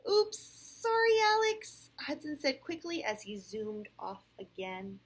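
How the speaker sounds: clear, animated read-aloud; storybook voice with lively rises and falls; picture-book narration. A narrator's reading. Parent reads Sam's story to a child, animated storytelling.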